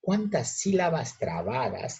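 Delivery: ordinary delivery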